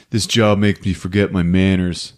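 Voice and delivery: ordinary talk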